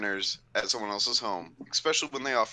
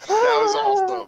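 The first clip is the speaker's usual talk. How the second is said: excited squeal; 10 dB louder than usual. Real, not acted. Excited squeal after joke.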